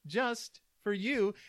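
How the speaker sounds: high, bright announcer welcome; cheery show intro over music; podcast host intro. Acted.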